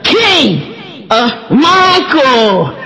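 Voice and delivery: put-on names stretched long in a North Carolina accent; Grandma's North Carolina accent. Grandma calling the cousins by name, drawling North Carolina accent. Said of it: "she said them wrong".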